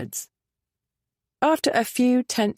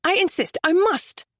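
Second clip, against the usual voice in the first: higher, urgent and pleading; agitated insistence; 10 semitones higher than usual. A narrator's reading. Lady pleading to go with him, agitated. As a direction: press the point anxiously.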